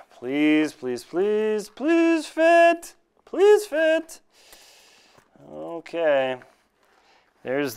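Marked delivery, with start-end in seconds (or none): 0.1-4.2 rising, begging please fit; pleading. Real, not acted. Pleading with the boot to fit, high and pleading. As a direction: beg the crampon to fit, voice climbing high.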